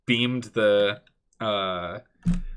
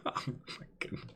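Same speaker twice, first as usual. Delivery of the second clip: laughing; 13 semitones higher, 14 dB quieter than usual. Real, not acted. Laughing at the drunk priest.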